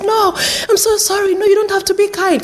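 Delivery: high-pitched, protesting apology; apologetic old lady. Retold or imitated. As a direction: act a humble old lady refusing kindness. Old resident protesting kindness, apologising.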